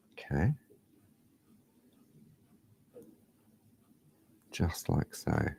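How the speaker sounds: unvoiced whisper, concentrating. Real, not acted. Showing feather tips to viewers, whispering. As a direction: breathe the words as you work. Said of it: "I don't know why I'm whispering".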